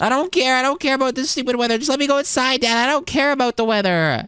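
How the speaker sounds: high, defiant whine; bratty whining. Acted. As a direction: whine back like a stubborn kid. Kid defies dad in roleplay.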